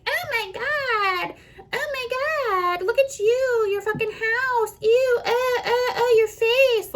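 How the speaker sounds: put-on high, slow, drawn-out mean-girl voice; mocking catty girl. Mimicking catty girls who mock her house and face. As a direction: sneer it like a catty gossip. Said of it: "all you fucking bitches that are like".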